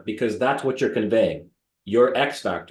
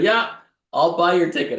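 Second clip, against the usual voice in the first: high, louder imitation of a mortgage broker; high put-on voice of a pal; Ted; 7 semitones higher, 4 dB louder than usual. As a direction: pitch it up, mimicking an eager friend. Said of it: "this is my Ted".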